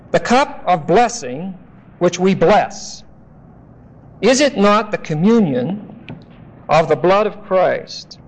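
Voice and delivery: slow, solemn reading of the verses; scripture reading. Acted. Pastor reading scripture aloud to the congregation, slow and solemn. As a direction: read the scripture slowly and deliberately.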